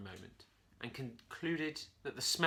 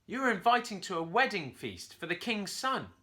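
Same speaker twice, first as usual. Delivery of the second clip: higher, louder monkey proclamation; monkey announcing; 8 semitones higher, 7 dB louder than usual. A narrator's reading. Curly announces the wedding feast to the parrot, loud. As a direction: announce the invitation grandly. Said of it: "Curly announced".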